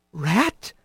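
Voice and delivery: soft, questioning near-whisper; hushed, wondering question. A narrator's reading. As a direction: say it softly, unsure. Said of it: "he heard Kylie whisper".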